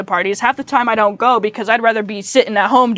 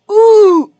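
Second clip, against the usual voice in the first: Roblox oof; 10 semitones higher, 8 dB louder than usual. Shown as a demonstration. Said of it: "that's not good either".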